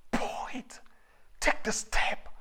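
unvoiced urgent whisper. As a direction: breathe the words out urgently. Preacher drops to whisper.

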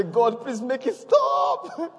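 high, slow laughing plea; hammed-up laughing plea of the angels; angels' pleading cry. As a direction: beg God to stop the noise, half laughing. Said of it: "they say".

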